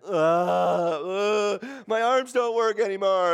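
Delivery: hammed-up exhausted kid complaining; whiny kid. Dad imitating his daughters whining about cleanup.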